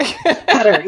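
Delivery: Qatar said as CUT-er; echoed pronunciation. Shown as a demonstration. Host trying the linguist's answer, Qatar said as CUT-er. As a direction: echo Qatar like "cutter", first syllable stressed. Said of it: "How do you pronounce this name".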